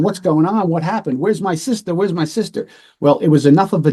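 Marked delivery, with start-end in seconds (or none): 0.0-2.7 a touch higher, panicked questions; an old man begging to know where his sister is; frantic uncle. Retold or imitated. Uncle pleading at the door, lightly voiced panic. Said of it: "The uncle's going".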